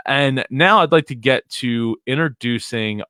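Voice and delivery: normal talking voice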